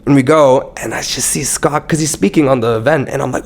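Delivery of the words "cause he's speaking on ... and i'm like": ordinary talk, nothing marked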